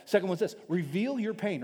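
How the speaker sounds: ordinary delivery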